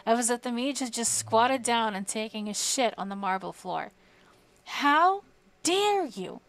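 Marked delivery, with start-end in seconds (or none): 4.6-6.4 high, slow, drawn-out furious bellow; bellowed outrage. Acted. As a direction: Archmage roaring at the mage in disgust. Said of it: "bellowed the Archmage".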